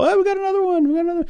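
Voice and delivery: high giddy excited voice; squealing with glee. Retold or imitated. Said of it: "Troy will send out a, a text to us all".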